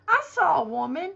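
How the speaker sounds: usual speaking voice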